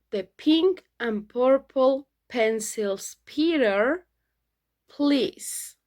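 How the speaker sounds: slow, careful tongue twister drill, each word separate. Shown as a demonstration. Teacher modeling a tongue twister for students, very slow. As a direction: spell it out slowly for learners. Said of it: "Un trabalenguas lo decimos primero despacio".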